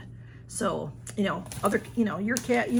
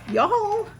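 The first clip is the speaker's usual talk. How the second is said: high, loud call to the viewers; squealing to the audience in delight; excited shout; 12 semitones higher, 5 dB louder than usual. Real, not acted. Showing viewers a gift, calling out high and loud.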